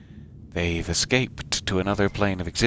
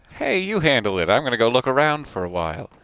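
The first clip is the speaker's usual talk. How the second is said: higher, louder mock brush-off; breezy brush-off; 5 semitones higher, 5 dB louder than usual. Hero leaving poor Josephine to handle the diplomats, breezy brush-off. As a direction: toss the job off, higher and louder.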